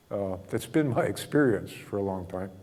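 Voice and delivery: ordinary delivery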